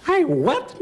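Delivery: high, loud outraged mother; indignant mom. Retold or imitated. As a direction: snap back like an offended mother. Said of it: "my mother said".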